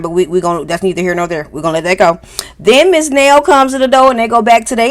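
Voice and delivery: ordinary talk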